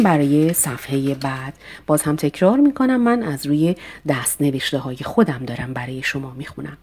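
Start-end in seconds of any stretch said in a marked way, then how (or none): none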